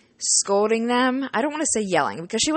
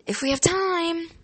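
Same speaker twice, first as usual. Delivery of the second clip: wry dodge; 9 semitones higher than usual. Retold or imitated. Teasing reply to the kids.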